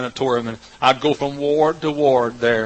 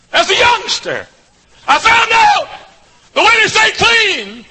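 soaring, shouted preacher climax, much higher and louder; preaching at full force; 12 semitones higher, 9 dB louder, slower than usual. Acted. Preacher thanking God before his congregation, full-force shout. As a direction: lift the crowd, shouting the testimony high.

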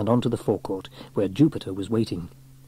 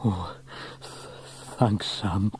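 shaken, halting stammer; gulping; 6 dB quieter, slower than usual. A narrator's reading. Trevor just pulled to safety by Sam, gulping. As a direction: gulp and stumble over the thanks. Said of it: "gulped Trevor".

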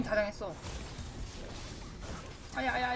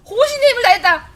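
호신의 힘을 다했다 yelled high and loud through laughter; 호신의 힘을 다했다 howled, laughing; laughing victory cry; 6 semitones higher, 10 dB louder than usual. Real, not acted. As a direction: crow 호신의 힘을 다했다 like a champion. Triumphant catchphrase after the hit.

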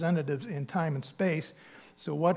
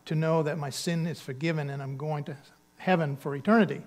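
normal talking voice

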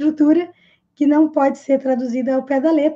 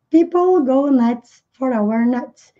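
slow and even, teacher modelling; slow model sentence; slower than usual. Shown as a demonstration. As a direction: spell it out slowly for learners.

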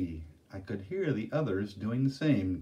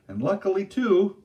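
teasing; 10 semitones higher, 8 dB louder than usual. Acted. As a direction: stretch out too to tease. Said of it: "drew out the last word teasingly".

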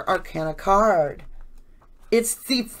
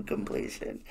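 breathy whisper of the word completion, fully unvoiced; whispered echo; 12 dB quieter than usual. Tarot reader to viewers, softly whispering the repeated word. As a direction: whisper completion back to yourself, soft and hushed.

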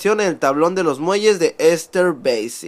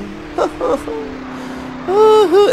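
high laugh. Real, not acted. Laughing at game taunt.

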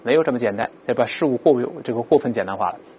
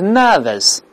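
British nervous, higher, with no r at all; British pronunciation; 4 semitones higher than usual. Shown as a demonstration. Teacher contrasts the two accents, British nervous without r. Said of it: "英国是nervous".

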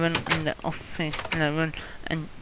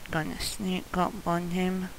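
ordinary talk, nothing marked

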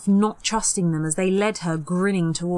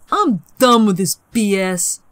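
louder, disgusted; fed-up boy; 2 semitones higher, 7 dB louder than usual. Acted. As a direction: throw in the towel angrily. Peter quitting.

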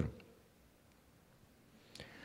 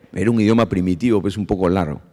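ordinary delivery

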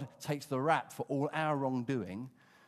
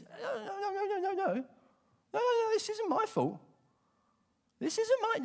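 much higher whining, half-laughing denials; wriggling denial; 5 dB louder than usual. Retold or imitated. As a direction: squirm out of blame in a high whine. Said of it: "He's wriggling like a fish on a hook".

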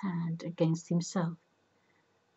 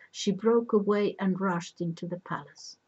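ordinary delivery

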